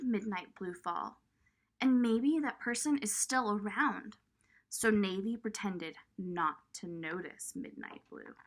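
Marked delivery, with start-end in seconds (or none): none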